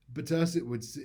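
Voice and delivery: ordinary talk, nothing marked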